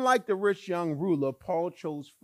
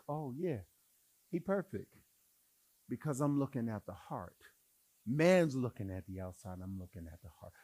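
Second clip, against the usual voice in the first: God's calm answer; 5 semitones lower, 6 dB quieter than usual. Retold or imitated. God voiced, calm answer.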